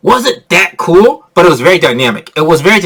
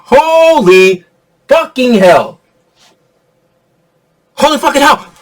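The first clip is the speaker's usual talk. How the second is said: excited exclamation; 7 semitones higher, slower than usual. Real, not acted. Excited about the fight.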